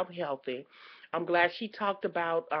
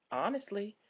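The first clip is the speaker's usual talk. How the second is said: ordinary talk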